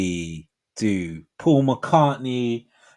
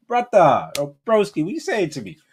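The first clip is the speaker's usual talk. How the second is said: high, loud bro greeting; put-on street voice; 6 semitones higher, 5 dB louder than usual. Shown as a demonstration. Man speaking to his bros, in put-on street voice. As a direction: hail a broski in exaggerated slang voice. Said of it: "not man them, but that kind of thing".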